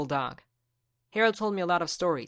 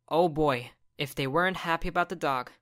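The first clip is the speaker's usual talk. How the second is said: ordinary delivery, nothing marked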